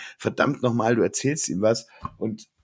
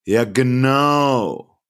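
very slow, stretched sarcastic agreement; drawn-out mock ja genau; 4 dB louder, much slower than usual. Imitating coworker Robert's sarcastic reply: slow drawn-out ja genau. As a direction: drag it out like a bored coworker. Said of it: "T-Shirt, wo drauf steht".

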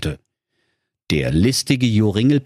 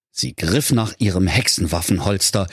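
ordinary delivery, nothing marked

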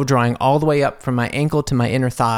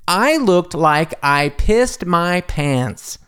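very slow, higher, word by word; emphatic drawn-out confession; 4 semitones higher, much slower than usual. Real, not acted. Extra confessing the wet-pants disaster, drawn-out emphasis. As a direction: stretch out every word for comic weight. Emphatic punchline of embarrassing story.

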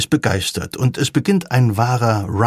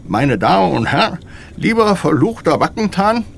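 slurred speech; 7 semitones higher than usual. A narrator's reading. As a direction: slur every word like a numbed tongue. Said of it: "mit geschwollener Zunge lallend".

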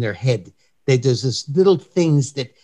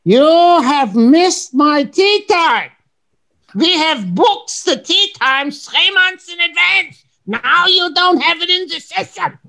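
much higher, slow, hammed-up German accent; German tourist; 4 dB louder, slower than usual. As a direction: play the indignant German guest who lost his tee time. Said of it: "I was acting like this German".

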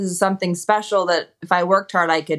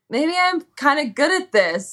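high-pitched, playful quote of younger self; retold dawning realization; 10 semitones higher than usual. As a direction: wonder aloud, pitched up high. Retelling her childhood realization.

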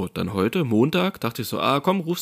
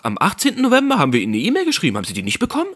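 clerk asking; 7 semitones higher, faster than usual. Retold or imitated. Clerk asks about e-mail.

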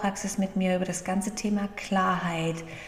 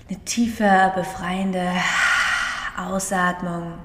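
guided meditation. Acted. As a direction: gently guide the breathing, unhurried. (ruhige Meditationsstimme)

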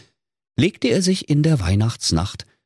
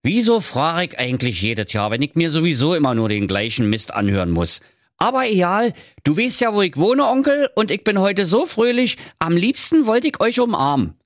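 high, bright nephew voice, briefly exasperated then happy again; a sunny nephew who won't be discouraged; high, cheerful young nephew; 11 semitones higher than usual. A narrator's reading. Nephew speaks.

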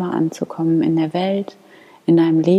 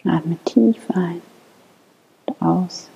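guided meditation. Acted. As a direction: lead them gently into calm prayer. Leads a guided morning prayer.

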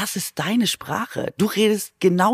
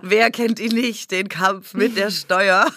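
hammed-up ad voice; slower than usual. Mock ad voice.

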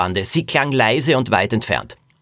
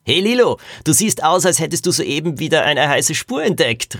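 put-on higher, cheeky boy voice calling across the deck; playful kid calling out across a ship; teasing boy Axel; 5 semitones higher than usual. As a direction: tease her like a cocky younger boy.

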